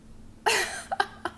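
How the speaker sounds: high-pitched burst of laughter. Real, not acted. Laughing at the man's complaint about her looks, high burst of laughter.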